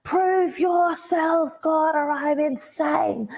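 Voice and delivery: very high, long-drawn scream at God; desperate demand, acted as a drawn-out shriek; re-enacted screaming. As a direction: stretch every word into a high yell. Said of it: "I'm relentlessly just screaming to God".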